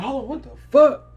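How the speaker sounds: high, loud double-take; shocked outburst. Retold or imitated. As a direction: blurt it out, retelling the shock. Acts out shock at seeing himself.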